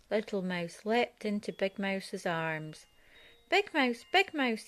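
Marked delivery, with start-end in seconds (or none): 3.5-4.7 crying out. Acted. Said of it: "he cried".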